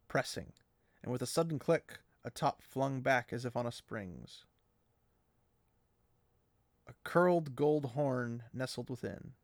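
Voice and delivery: steady read-aloud narration; calm audiobook reading; story narration. A narrator's reading. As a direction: read the passage to the listeners. Reads the book passage aloud.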